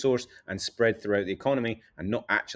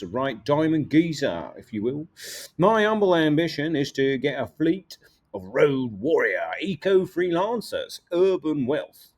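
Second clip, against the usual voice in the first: put-on light Cockney accent, a bit higher; a hint of a Cockney businessman; Cockney geezer; 3 semitones higher than usual. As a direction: play a Cockney wide boy. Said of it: "a sort of Cockney rhyming slang type person".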